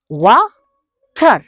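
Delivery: वाटर split into two syllables, very slow with a break; syllable demo. Shown as a demonstration. Teacher showing two syllables, slow broken word. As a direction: say वाटर as वा-टर, pausing between parts. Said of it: "बीच में हम रुक रहे हैं".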